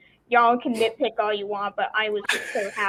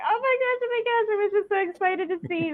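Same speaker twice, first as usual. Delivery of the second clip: much higher, giddy squeal of delight; fangirl excitement; 10 semitones higher than usual. Retold or imitated. Recalling seeing Anakin on screen, a giddy high-pitched squeal. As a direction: gush like a little girl seeing her hero. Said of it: "I'm just like".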